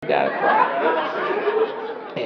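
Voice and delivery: high, very slow sung-out pray; mock church lady. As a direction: stretch it like a churchy singer. Preachy drawn out.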